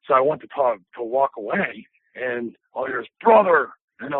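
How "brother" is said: loud, higher-pitched wrestler call; hammed-up imitation calling someone back; Hulk Hogan. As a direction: punch it out like a big wrestling star.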